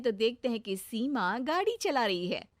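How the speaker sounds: ordinary delivery, nothing marked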